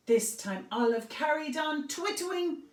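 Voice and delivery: ordinary delivery, nothing marked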